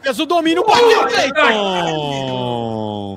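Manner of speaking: fast radio match call; live play-by-play. Acted. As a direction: narrate the attack live.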